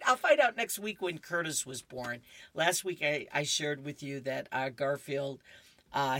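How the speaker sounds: ordinary delivery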